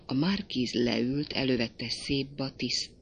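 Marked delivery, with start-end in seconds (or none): none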